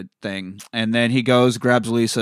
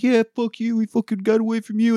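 high, jeering taunt, half acted; mock taunting; 12 semitones higher than usual. Retelling a movie hero taunting the monster, high mock jeer. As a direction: toss a gloating jab at the monster. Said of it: "he's, like, talking shit".

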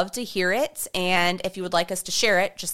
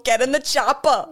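mock Austrian accent; 4 semitones higher, 4 dB louder than usual. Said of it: "that sounded like Boston".

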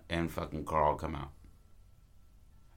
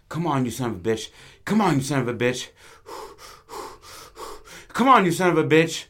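building from raised voice to high, loud yell; acted threat, louder and higher each time; yelled challenge; 7 semitones higher, 7 dB louder, faster than usual. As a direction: goad an unseen enemy, escalating to a shout. Greasers with knives challenge the rustling.

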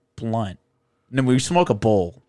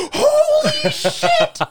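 very high, slow, laughing "holy shit"; laughing overjoyed; 5 dB louder, slower than usual. Retold or imitated. Eric seeing the decorations, laughing very high. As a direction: laugh it out, touched and stunned.

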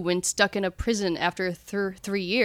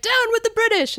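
mock rally cry; 14 semitones higher than usual. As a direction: shout it like a cheeky rebel. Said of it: "they just march up to the British".

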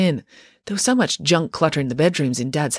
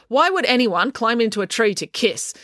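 worked up, high and urgent; impassioned speech; 8 semitones higher than usual. A narrator's reading. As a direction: rant on, growing flustered. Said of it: "delivering this impassioned speech".

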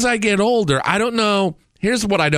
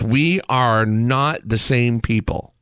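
usual speaking voice

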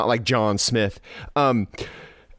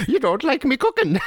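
higher Irish lilt through a laugh; leprechaun; 13 semitones higher than usual. Retold or imitated. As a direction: mimic the brogue while laughing. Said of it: "You made him sound like Leprechaun there".

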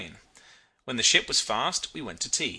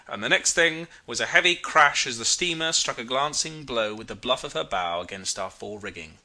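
ordinary delivery

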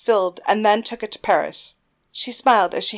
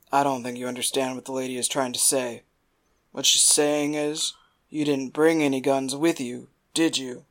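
lower, softer, lightly voiced; Philocides, quiet menace; 6 semitones lower, 4 dB quieter than usual. Philocides quietly threatens the men, menacing. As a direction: lean on them quietly.

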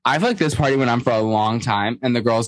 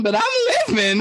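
high laughing squeal; high laughing drag voice; laughing drag queen. Retold or imitated. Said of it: "giving very much Trinity the Tuck".

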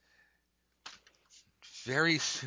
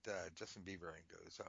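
usual speaking voice

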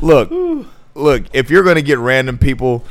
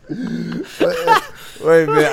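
laughing wait; giggling; 3 semitones higher, much slower than usual. Real, not acted.

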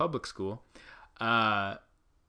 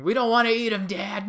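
put-on high, loud whine of a protesting child; complaining kids; 10 semitones higher, 6 dB louder than usual. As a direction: whine it at Dad like mock kids. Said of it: "his kids are over there complaining".

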